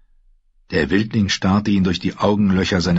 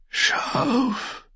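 faint, breathed last word; dying gasp; 6 dB quieter than usual. Acted. Dying ranger's final word to his killer, gasped. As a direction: let it out weakly on a last breath.

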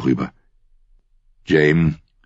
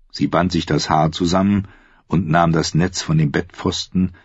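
usual speaking voice

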